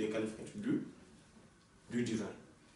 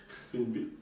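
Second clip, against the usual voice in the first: laughing; 2 semitones lower, 11 dB quieter than usual. Real, not acted. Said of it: "laughing aside".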